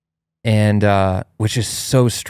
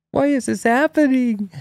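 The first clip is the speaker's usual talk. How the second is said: put-on very high, piping little-boy question; cute plaintive child. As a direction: squeak it like a small bewildered kid. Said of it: "He's so cute".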